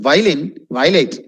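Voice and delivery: Violin, violate as "VY-lin, VY-late"; wrong pronunciation. Shown as a demonstration. Teacher mimicking learners' mistake, mispronounced.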